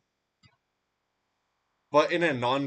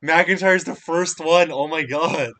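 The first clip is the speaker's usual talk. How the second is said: slow, louder awed exclaiming; awed excitement; 4 semitones higher, 6 dB louder than usual. Real, not acted. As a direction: let the shock sink in. Stunned at a wrestling upset.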